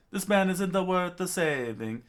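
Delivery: high, loud protest; impassioned outcry. Acted. Chopper thinking of the doctor's words, impassioned outcry. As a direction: cry it out in indignation.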